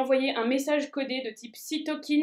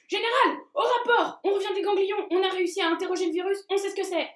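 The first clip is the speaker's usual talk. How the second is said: high, eager little soldier; 7 semitones higher than usual. Acted. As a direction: report back, high and quick. Dendritic cells report back.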